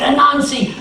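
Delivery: hurry, bang on the door; urgent neighbors. Acted. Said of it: "They said".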